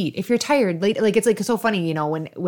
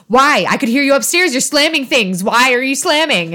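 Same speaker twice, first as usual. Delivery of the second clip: accusing; 5 semitones higher, 6 dB louder than usual. Retold or imitated. Retold confrontation, accusing husband.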